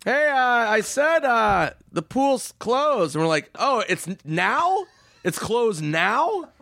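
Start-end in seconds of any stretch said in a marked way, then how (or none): 0.0-3.1 high, slow dopey guard voice; drawn-out sleepy guard; mock security guard. Guard telling them the pool's closed, dopey and slow. 3.6-6.5 high, drawn-out fake disbelief; mock shock. Friends faking disbelief at the guard, hammed-up.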